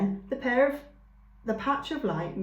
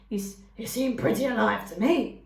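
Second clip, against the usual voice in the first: skunk voice; 2 semitones higher, 3 dB louder than usual. A narrator's reading. Skunk replies playfully.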